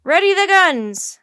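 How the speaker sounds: high, very loud captain's order; drawn-out forceful order; commanding shout. Acted. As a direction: bellow a command to the crew.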